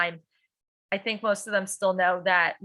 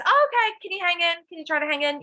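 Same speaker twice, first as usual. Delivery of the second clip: gentle encouragement; 7 semitones higher, faster than usual. Retold or imitated. Teacher gently urging a sleepy student to hang in, high and kind. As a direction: coax a tired kid to keep going.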